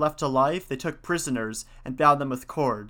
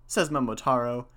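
usual speaking voice

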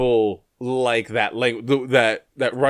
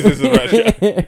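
put-on Russian accent; 6 semitones higher, 4 dB louder, slower than usual. Mocking Russian toughness.

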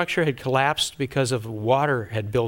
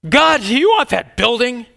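higher, louder exasperated demand; frustrated pastor praying on the floor; 9 semitones higher, 7 dB louder than usual. Retold or imitated. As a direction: throw the question at God. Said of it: "I was just kind of frustrated".

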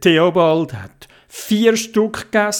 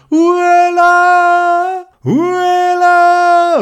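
long high call, much louder; 7 dB louder, much slower than usual. Acted. Theobald calls up to the crane man, long and loud. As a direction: hail the crane driver from far below.

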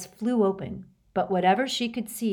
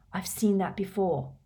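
whispering. Acted. Harry recognizing cursed object.

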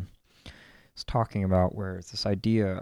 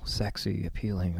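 ordinary delivery

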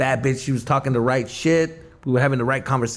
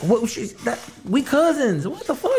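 high-pitched, incredulous protest; mock shocked; 9 semitones higher than usual. Cousin to flirting relative, mock shocked. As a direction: act thrown and bewildered, pitched way up.

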